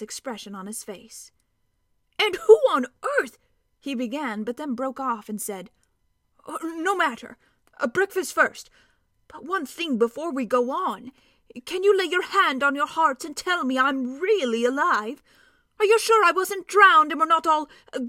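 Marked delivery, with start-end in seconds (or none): 2.2-3.4 much higher and louder surprised cry; startled outburst. A narrator's reading. Dwarf startled by the children. 6.4-18.0 higher, louder pleading dwarf; a dwarf begging to be reassured; anxious dwarf. A narrator's reading. Dwarf character voice.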